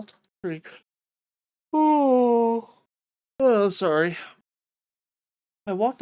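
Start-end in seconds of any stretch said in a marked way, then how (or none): 1.7-4.2 startled oh. Real, not acted. Streamer catches own misreading.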